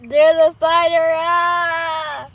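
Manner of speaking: loud startled scream, high; screaming at a spider. Real, not acted. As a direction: yelp in real fright at a spider. Sees a spider while driving.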